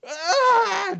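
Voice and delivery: sharp yelp of pain; pained cry. Retold or imitated. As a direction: cry out suddenly. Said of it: "cries and then".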